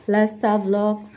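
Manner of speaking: ordinary talk, nothing marked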